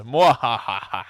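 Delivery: loud hammy evil cackle; slow villain mwahaha; mad scientist laugh. Pretend. Evil laugh after messenger line.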